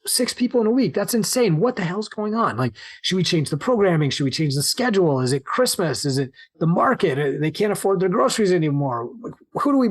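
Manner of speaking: imitating panicked staff. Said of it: "my staff was kind of freaking out".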